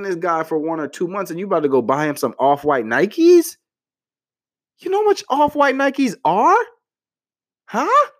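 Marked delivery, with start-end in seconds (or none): 4.8-8.1 much higher, disbelieving question; shocked at the price; incredulous. Real, not acted. Vlogger scolding ladies over pricey sneakers, shocked high voice.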